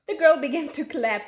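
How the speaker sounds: ordinary delivery, nothing marked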